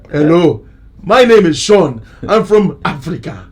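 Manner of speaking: mock African accent, emphatic self-introduction. Second man in the joke lineup, mock African accent. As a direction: put on a broad African accent for the next man. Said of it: "Speaking in English with the accent".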